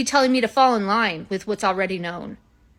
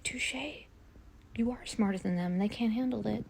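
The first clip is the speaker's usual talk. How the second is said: hushed agreement; 12 dB quieter than usual. Acted. Crystal answers AI softly.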